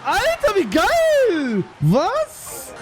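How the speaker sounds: hyped yell of joy; thrilled exclamation. Real, not acted. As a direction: burst out in delight. Thrilled by play.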